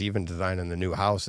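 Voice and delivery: ordinary talk, nothing marked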